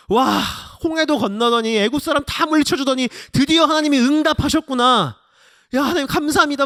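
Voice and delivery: high, loud shouts of relief and thanks; overjoyed acted cheer. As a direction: exult like a crowd whose prayer is answered. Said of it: "사람들은 기뻤을, 엄청 기뻤을 거예요".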